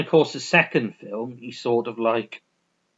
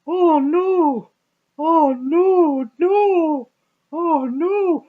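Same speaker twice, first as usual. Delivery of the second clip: high squeaky frantic ape voice, repeating in alarm; hammed-up high monkey panic; panicky chimp voice; 5 dB louder, much slower than usual. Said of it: "he looks at Maurice and he goes".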